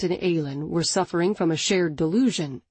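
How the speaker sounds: plain reading voice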